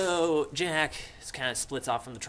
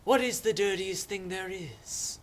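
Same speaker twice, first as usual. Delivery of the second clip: higher, slow put-on pondering voice; Simon; 6 semitones higher than usual. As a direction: pose it slowly like an odd mystic. Simon musing, acted.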